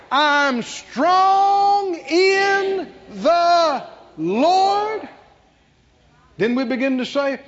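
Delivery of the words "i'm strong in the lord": each word high, loud and drawn out; very slow, emphatic shout on all words; stress on every word. Shown as a demonstration. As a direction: proclaim it word by word, stretching "strong", "in", "the" and "Lord". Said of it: "begin to emphasize each word".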